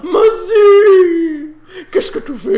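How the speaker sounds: high, loud French lady; shrill French madame calling out; Madame Hassini voice. Pretend. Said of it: "Mummel voice so much as Madame Hassini".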